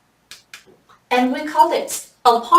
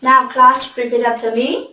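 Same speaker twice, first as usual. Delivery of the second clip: usual speaking voice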